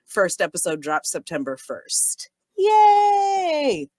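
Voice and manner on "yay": a high, loud cheer of joy; excited yay. Real, not acted. Host cheering the podcast's first episode, high excited shout. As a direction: shout it out, thrilled about the launch.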